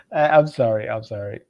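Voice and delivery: usual speaking voice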